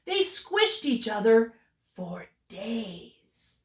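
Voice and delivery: usual speaking voice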